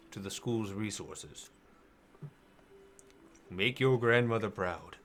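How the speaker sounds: low, measured wizard councillor; deliberate, deep voice of a senior wizard; Parthon. Acted. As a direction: give parting counsel low, with long pauses. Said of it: "it's the voice of Parthon".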